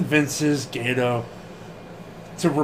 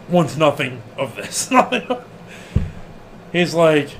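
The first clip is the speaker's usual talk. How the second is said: ordinary delivery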